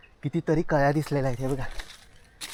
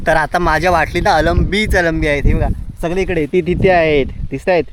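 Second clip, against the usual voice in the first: louder, thrilled calling out at the find; excited; 3 semitones higher, 7 dB louder than usual. Real, not acted. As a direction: point them out one after another with growing delight. Finds mushrooms everywhere. (अळंबीच अळंबी पाहून मोठ्याने उत्साहात)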